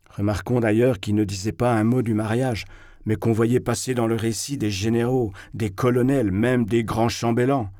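plain reading voice

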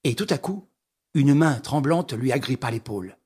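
ordinary delivery, nothing marked

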